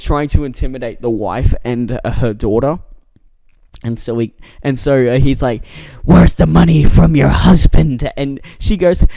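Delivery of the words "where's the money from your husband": loud, hammed-up Boston tough guy; bad Boston accent. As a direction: bark it in a terrible Boston accent, intimidating. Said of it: "the worst Boston accent".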